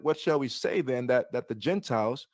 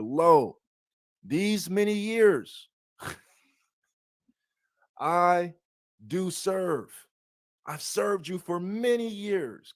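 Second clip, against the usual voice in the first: aggrieved elder son; 4 semitones higher, 4 dB louder, slower than usual. Acted. Elder son complains to father.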